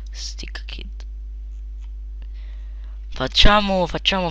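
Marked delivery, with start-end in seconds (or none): 0.1-1.1 unvoiced whisper of the option name; whispering to himself. Real, not acted. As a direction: breathe the name, no voice. Reading options under breath.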